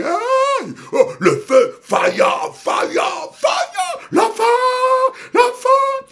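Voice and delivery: very high, loud repeated shouts; escalating imitation of screamed prayer; mock screaming. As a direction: shriek like a frenzied worshipper. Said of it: "parce que vous criez".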